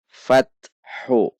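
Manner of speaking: Fathu said slowly and clearly; Arabic syllable demo. Shown as a demonstration. Teacher shows students how to read it: Fathu drawn out. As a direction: model Fathu for the students to copy. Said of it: "Perhatikan cara membacanya".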